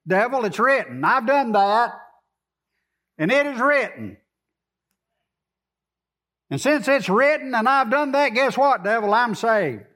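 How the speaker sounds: mock showdown with the devil, growing bolder; building defiant rebuke. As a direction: start firm, climb to a bold victory. Preacher rebukes devil, acted dialogue.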